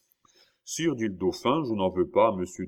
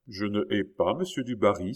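plain reading voice

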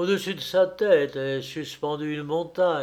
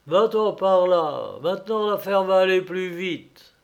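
higher, louder exclamation; triumphant dismissal; 5 semitones higher, 5 dB louder than usual. A narrator's reading. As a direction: send it packing, raised voice. Grandfather orders the stone off.

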